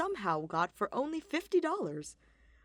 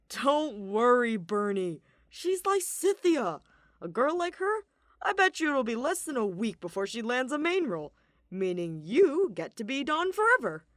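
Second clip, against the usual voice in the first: hearty man voice; 5 semitones higher, 7 dB louder than usual. Acted. Balthus reassuring.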